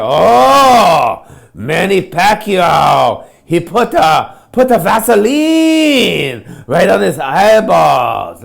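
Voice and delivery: loud, slow, drawn-out fight announcer call; excited announcer. Retold or imitated. Comic telling the crowd about boxers, loud announcer call. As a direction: hype it up like a boxing announcer.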